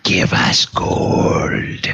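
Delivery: slow hissing growl; gnoll voice. Acted. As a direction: hiss the demand slowly. Gnoll repeats demand.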